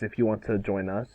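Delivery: usual speaking voice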